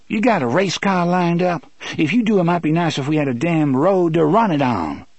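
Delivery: high-pitched, sassy challenge; truculent Susanna. Acted. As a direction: needle him in a high character voice.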